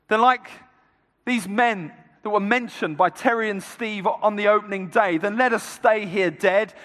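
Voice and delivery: rally the troops with emphatic resolve; rallying battle speech. Acted. Leader rallying the church to stand and fight, forceful oratory.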